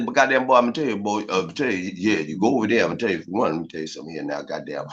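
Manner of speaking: put-on low, gruff old-timer with a cigarette in his mouth; old mechanic voice. Imitating old mechanics who talk with a cigarette, low and gruff. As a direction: drop way down into an old OG's voice. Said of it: "And they talk like this".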